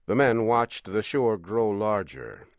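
ordinary delivery

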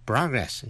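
American "Progress", PRAH-gress; teacher's repeat of American "Progress", first vowel open; American pronunciation. Shown as a demonstration. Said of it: "nếu đọc theo giọng Mỹ".

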